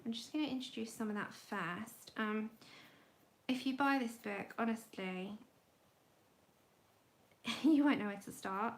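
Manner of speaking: ordinary talk, nothing marked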